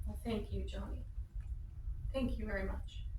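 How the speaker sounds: wife quietly thanking her husband for the dresses; soft and moved. Acted. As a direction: barely get the words out, touched. Wife moved by baby gifts.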